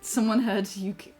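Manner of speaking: usual speaking voice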